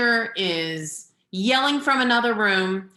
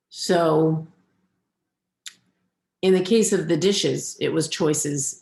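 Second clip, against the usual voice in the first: normal talking voice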